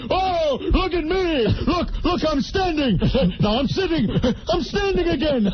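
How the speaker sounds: high, hammed-up manic improviser; Robin Williams. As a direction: show off like a hyperactive comic, mockingly. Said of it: "Oh, he's improvising".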